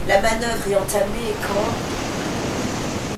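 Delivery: usual speaking voice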